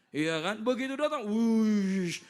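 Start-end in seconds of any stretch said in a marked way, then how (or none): 1.3-2.2 loud drawn-out airy whoosh. Acted. Preacher shows the jinn arriving, loud drawn-out whoosh. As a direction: blow out a rushing spirit sound. Repeated imitation of jinn's arrival.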